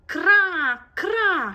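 very loud, high bird caw; loud crow caw. Acted. Max caws loudly to attract the children, a loud high caw. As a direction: shriek like a jackdaw. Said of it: "strigă".

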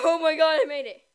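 loud laugh of relief; relieved laughter. Real, not acted. Survives the round.

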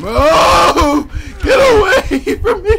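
high, loud panicked screaming; terrified scream. Real, not acted. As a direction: scream at the monster to get away. Chased by monster.

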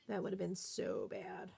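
breathy whisper of relief; hushed relieved whisper; whispered aside. Real, not acted. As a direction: whisper it to yourself after a near miss. Repeats it under her breath.

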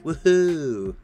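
ordinary talk, nothing marked

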